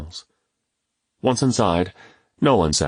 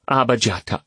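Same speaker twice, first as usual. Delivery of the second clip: put-on children's cheer; 5 semitones higher than usual. A narrator's reading. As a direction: chant the name happily.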